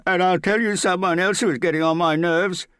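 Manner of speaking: very high, loud outburst; sudden shout. A narrator's reading. Morgan suddenly rages at Hester about his nerves, shouting. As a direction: explode into an angry shout. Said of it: "suddenly beginning to shout".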